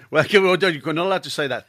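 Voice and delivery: ordinary delivery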